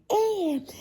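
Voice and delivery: high, loud hyped-up start; high, loud excited outburst; escalating voice. Retold or imitated. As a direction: launch into it all hyped up. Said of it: "and then my voice starts to escalate".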